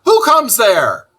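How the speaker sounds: high, loud sentry bark; sharp challenge. Acted. Ferret sentry challenges Toad, sharp and loud. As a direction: snap it out like a guard. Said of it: "said the ferret sharply".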